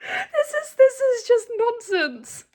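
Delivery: high, crying laugh; laughing disbelief. Real, not acted. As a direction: squeak it out, near tears from laughing. Laughing outrage at the book.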